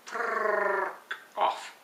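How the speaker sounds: frrrk with a long rolled R, high; frrrk, R rolled like a Scot. Shown as a demonstration. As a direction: trill the R in frrrk. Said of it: "roll your R's".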